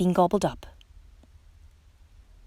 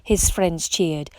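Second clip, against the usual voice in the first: usual speaking voice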